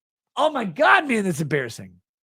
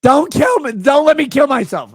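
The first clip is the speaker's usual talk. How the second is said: high, louder whiny mock plea; mocking pleading fan; 9 semitones higher, 9 dB louder than usual. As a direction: beg dramatically in mockery. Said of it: "I'm like".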